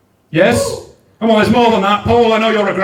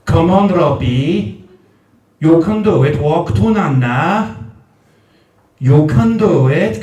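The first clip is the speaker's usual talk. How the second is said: stretched vowels in a swooping Newport lilt; Newport accent; 5 semitones lower than usual. Retold or imitated. Granny Gail coaxing baby Robbie to Nana, Newport accent. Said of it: "the accent".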